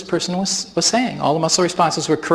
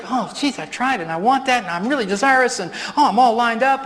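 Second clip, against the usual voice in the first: whiny frustrated believer; 6 semitones higher than usual. Retold or imitated. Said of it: "you say".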